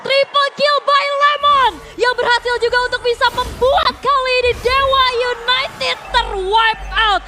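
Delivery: high, loud announcer hype; fighting game announcer. Real, not acted. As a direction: bellow the triple kill like an arena announcer. Caster calls triple kill. (suara announcer game yang heboh)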